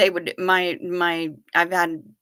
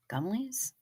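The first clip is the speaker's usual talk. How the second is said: soft, breathy whispered question; a hushed, wistful whisper of Gummies?; 3 semitones higher, 11 dB quieter than usual. Real, not acted. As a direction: whisper it like a secret craving. Whispered echo of the word.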